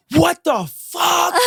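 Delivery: high, slow, hammed-up yell at mom; retold screaming match. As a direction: bellow it like a son fed up mid-argument. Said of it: "I'm screaming at her".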